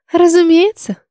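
high, loud, offhand "of course"; breezy, dismissive witch; careless reply. A narrator's reading. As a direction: toss it off airily. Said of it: "небрежно ответила колдунья".

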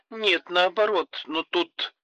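high, slow, faltering; trailing off, unsure how to go on; hesitant. Acted. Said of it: "Она замялась". (запинаясь, неуверенно)

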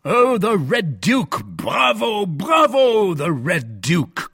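high, drawn-out cheering; gleeful, exclaiming approval; delighted cry. A narrator's reading. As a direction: applaud the joke with hearty bravos. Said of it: "cried Porthos, clapping his hands".

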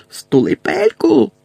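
high, slow shrieking shout; furious shriek. Acted. Bellatrix screams at Harry to shut up, high and furious. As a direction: scream him silent. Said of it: "крикнула Белатриса".

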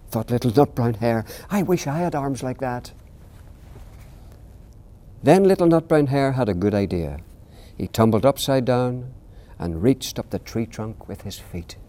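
put-on gentle read-aloud for children; storybook reading. A narrator's reading. Author reading his bedtime book aloud to interviewer, gentle storytelling.

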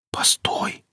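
urgent breathless whisper. Acted. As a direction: hiss it under your breath. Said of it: "прошептал Никита".

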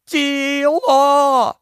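one high, desperate bellowed word; yell at the top of his lungs. A narrator's reading. Ed yelling the word to the whole crowd. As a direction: scream it with all your breath. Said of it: "заволав Ед на межі сили своїх легень".

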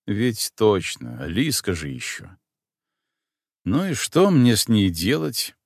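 ordinary delivery, nothing marked

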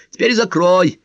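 high-pitched exasperated mother; scolding mother. Acted. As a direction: play a nagging mum ordering her child to eat. Mother act ordering son to eat.

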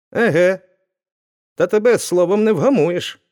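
fed up before the blow; the blacksmith losing his temper; angry. A narrator's reading. Smith loses patience, strikes.

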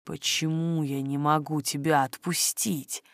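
soft, low, intimate murmur; whisper in the ear. A narrator's reading. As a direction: ask it low, right into the ear. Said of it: "шепча в самое ухо".

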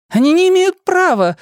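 high, loud outraged cry; shouting protester. Acted. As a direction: yell it at him in protest.

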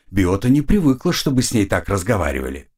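ordinary delivery

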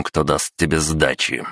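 ordinary delivery, nothing marked

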